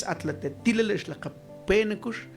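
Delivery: ordinary talk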